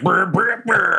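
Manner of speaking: high sputtering raspberry bursts; imitated fart noise. Player imitates his signal fart, high sputtering noise. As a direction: sputter out three mock farts. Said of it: "that fart's like".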